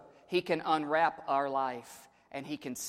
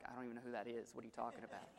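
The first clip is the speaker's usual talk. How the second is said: put-on teen voice; 6 semitones lower, 18 dB quieter, much faster than usual. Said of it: "you're like".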